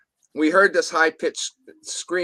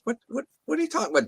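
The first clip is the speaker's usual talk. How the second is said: high, rapid stammered disbelief; baffled retort; 9 semitones higher, 3 dB quieter, much faster than usual. Retold or imitated. Questioning his friend's odd claim in a retold exchange, baffled. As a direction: blurt it out fast and high, acting out bafflement.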